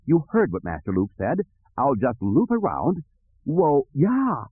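fussy droid rising into high alarm; flustered droid. Acted. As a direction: start prim, climb to a high cry. Droid piloting the ship.

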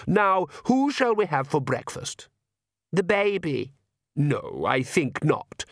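lightly voiced vampire father, high then dropping; the Count, musing. A narrator's reading.

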